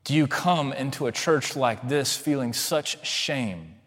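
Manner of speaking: ordinary delivery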